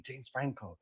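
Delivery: hushed, unvoiced name drop; mock whisper. Host imitating the actor proving himself real, mock whisper.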